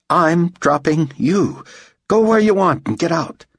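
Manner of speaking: high, emphatic dismissal; exasperated. A narrator's reading. Director dismissing his driver, exasperated. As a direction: lay it down firmly to be rid of him.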